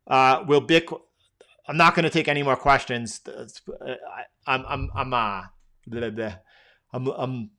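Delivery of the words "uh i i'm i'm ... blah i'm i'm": soft, halting, losing steam; fading out. Real, not acted. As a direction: stumble over the words as energy runs out. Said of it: "I'm fading out over here".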